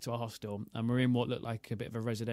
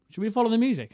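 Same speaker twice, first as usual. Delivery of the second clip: excited; 9 semitones higher, 6 dB louder, faster than usual. Retold or imitated. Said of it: "even you were like".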